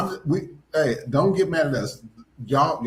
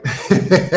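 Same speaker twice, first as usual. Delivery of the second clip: loud, higher-pitched laugh; 5 semitones higher, 6 dB louder than usual. Real, not acted. Host checking that the co-host is back, laughing. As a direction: laugh out loud after the question.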